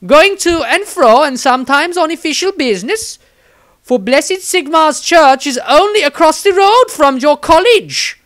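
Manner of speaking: high, loud, syrupy nun voice; zealous nun. A narrator's reading. Nun Angelique sweetly insists to the wizard, high and loud.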